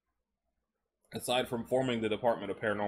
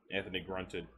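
usual speaking voice

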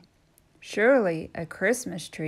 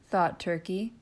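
plain reading voice

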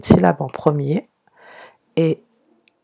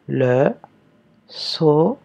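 "Le saumon" slowly dictated with a break after each syllable; "saumon" split into sau... mon; much slower than usual. Shown as a demonstration. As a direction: separate Le, sau and mon with clear pauses. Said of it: "première syllabe".

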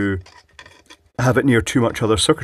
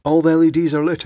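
higher, louder, pleased exclamation; excited; 6 semitones higher, 6 dB louder, faster than usual. Real, not acted. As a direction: announce it with delight. Lamp works again, delighted.

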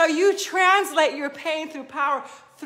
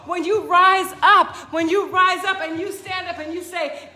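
ordinary talk, nothing marked

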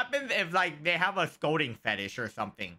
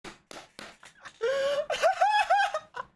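high, shouted burst of laughter; helpless high-pitched laughing shriek. Real, not acted. Laughs at sad story.

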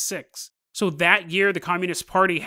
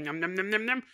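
soft chewing nom sounds; munch greedily and quietly; eating noises; 6 dB quieter than usual. Retold or imitated. Mom secretly eating the pigeons, soft chewing sounds.

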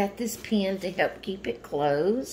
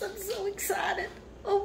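hushed excitement; 11 semitones higher, 7 dB quieter than usual. Real, not acted. Same gift, overcome.

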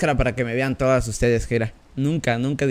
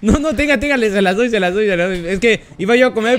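más alto y más fuerte, nervioso; entrega asustada; 5 semitones higher, 5 dB louder than usual. Acted. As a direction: suplica agitado, voz aguda y alta. Entrega fotos asustado ante cuchillo. (el periodista entrega las fotos, agudo y asustado)

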